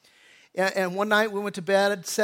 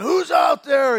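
loud, raised shout into the dark; reenacted scream; 6 semitones higher, 8 dB louder, slower than usual. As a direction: shout it as if confronting an intruder. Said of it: "I'm screaming".

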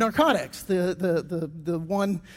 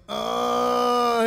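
groggy groan; 3 semitones higher than usual. Retold or imitated. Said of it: "the guy starts going".

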